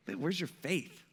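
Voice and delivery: low, hushed chiding; quiet reproach. Acted. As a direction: chide them softly, just woken up. Jesus just woken, chiding disciples.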